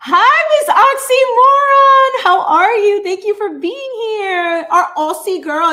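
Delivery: high, loud, drawn-out squeal of welcome; excited greeting. Real, not acted. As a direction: greet a favourite viewer at full squeal. Calling out greeting to a viewer.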